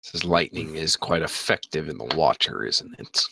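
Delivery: low, soft menacing priestess. Acted. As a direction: murmur it low like a sinister priestess. DM voices priestess.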